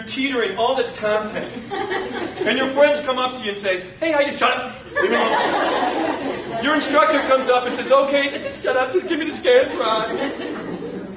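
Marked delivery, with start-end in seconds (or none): 4.0-6.6 slow, laughing mock brush-off; a hammed-up tired snap; mock snapping. Cramming student snaps at friends. 7.9-11.2 slow, laughing mock teacher; a light teasing teacher voice; mock impatient instructor. Instructor demands the test back.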